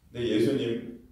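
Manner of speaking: ordinary talk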